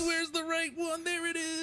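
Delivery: high-pitched calling out; high excited calling. Real, not acted. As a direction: call it out, voice way up. Searching the screen, shouting.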